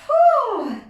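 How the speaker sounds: high, loud whew of relief; relieved loud exhale. Real, not acted. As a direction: blow out hard after the effort. Relief after final set.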